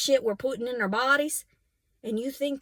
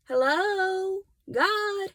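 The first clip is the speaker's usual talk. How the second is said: high, drawn-out call into the void; a lonely call to the heavens; mock call to God; 9 semitones higher, much slower than usual. Calling out to God.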